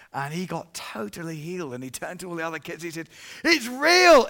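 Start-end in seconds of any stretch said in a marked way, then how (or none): 3.4-4.3 excited cry. Retold or imitated. Healed boy shouts to others.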